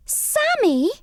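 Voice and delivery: high, shocked squeak of a name; shocked little mouse. A narrator's reading. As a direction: recoil at the name in dismay.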